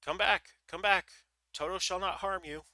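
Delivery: loud, high call to bring them back. A narrator's reading. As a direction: call after the fleeing mice. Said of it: "while he called to the mice".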